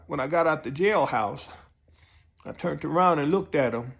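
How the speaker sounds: ordinary talk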